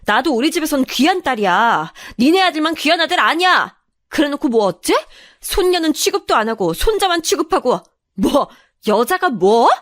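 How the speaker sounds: indignant, high-pitched tirade; vein-popping anger. Acted. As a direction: vent stored-up rage at the in-laws. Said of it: "저는 목에 핏대가 서도록 화를 냈지요".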